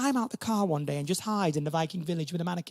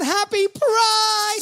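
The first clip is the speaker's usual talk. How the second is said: high, slow, stretched-out greeting; drawn-out cheer; 12 semitones higher, 6 dB louder, much slower than usual. Acted. Host greeting the Pride crowd, a drawn-out high cheer. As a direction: sing out the greeting long and high.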